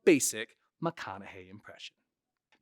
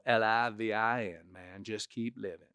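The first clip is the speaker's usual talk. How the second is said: Matthew McConaughey; 2 semitones lower, 4 dB quieter than usual. Shown as a demonstration. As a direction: stretch the words, then trail off softly. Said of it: "he'll hold words out".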